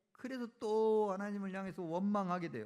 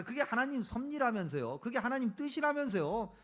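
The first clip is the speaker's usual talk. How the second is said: higher-pitched, needling concession; sarcastic acceptance; 4 semitones higher than usual. Acted. Prophet conceding to God's will, sarcastic and grudging. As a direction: throw God's own words back sarcastically. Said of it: "빈정되는 거예요".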